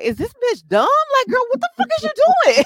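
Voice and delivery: high, fast, disbelieving squeal; incredulous. Retold or imitated. Friend retelling the apology call, in incredulous high squeal. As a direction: shriek it like you can't believe her nerve.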